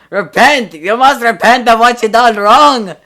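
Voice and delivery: high, loud preacher-like yell; old man yelling. Acted. Old man shouting at the teens, loud ranting yell. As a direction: bellow it like a crazed old street prophet. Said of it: "The guy starts yelling at us".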